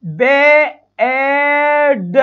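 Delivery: बेड stretched very slow on the long vowel; बेड with a long drawn ए. Shown as a demonstration. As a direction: hold the ए in बेड for a long time. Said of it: "बेड में देखो".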